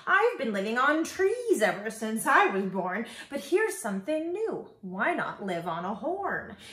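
high, loud, swooping bird chirp. A narrator's reading. Zinazoo bird admires a new home, chirping. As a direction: chirp it with big rises and falls. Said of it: "and he chirped".

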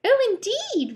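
very high, dainty voice; reply daintily, nothing like a pirate; prim lady rascal. Acted. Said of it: "replied the rascal".

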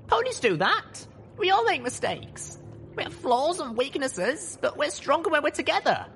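put-on high, fast determined young mare; young heroine voice. A narrator's reading. Voiced heroine Little Pip.

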